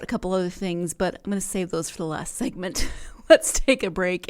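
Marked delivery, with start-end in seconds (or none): 2.9-4.3 laughing through the words; giggly, loud laugh. Real, not acted. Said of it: "I'll collect myself".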